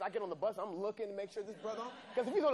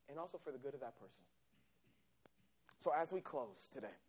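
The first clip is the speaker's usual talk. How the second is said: preaching close; 2 semitones lower than usual. Acted. Preacher sending the congregation out as witnesses. Closing exhortation.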